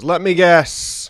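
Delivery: drawn out like he already knows; smug server. Retold or imitated. Subway server guessing the regular's order, smugly. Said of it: "He goes".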